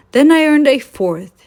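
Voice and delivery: put-on proud old engine brags a little; proud growth. A narrator's reading. Train proudly recounts growth.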